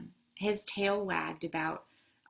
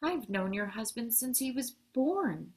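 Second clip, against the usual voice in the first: higher-pitched, mildly accusing reproach; 5 semitones higher than usual. A narrator's reading. Postman confronts Mama about the move, accusing. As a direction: say it as a hurt accusation.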